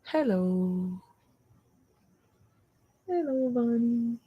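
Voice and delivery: soft, drawn-out hello to a pet; cooing to dog. Real, not acted. Owner greets her dog Bonnie up close, soft coo. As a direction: coo softly and stretch the vowels.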